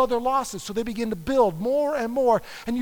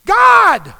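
loud, high cry to heaven; anguished outcry; 9 semitones higher, 14 dB louder than usual. Acted. Job protesting God's treatment: loud anguished outcry. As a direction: cry out at God in frustration.

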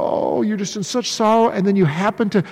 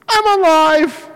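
mock feeble Jesus; 6 semitones higher, slower than usual. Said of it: "And he goes".

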